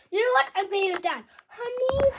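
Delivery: normal talking voice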